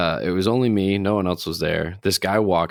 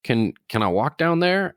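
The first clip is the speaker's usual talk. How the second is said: imitating the stranger; 5 semitones higher than usual. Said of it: "And he goes".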